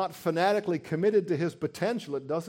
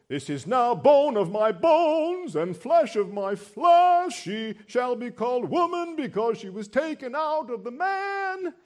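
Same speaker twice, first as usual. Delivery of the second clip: exultant reading; 9 semitones higher, 8 dB louder than usual. Acted. Adam exclaiming over his new bride, loudly exultant. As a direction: burst out with it like a song. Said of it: "we should kinda read it this way".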